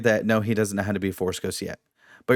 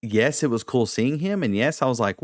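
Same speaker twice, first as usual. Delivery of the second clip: usual speaking voice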